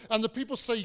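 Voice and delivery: ordinary talk, nothing marked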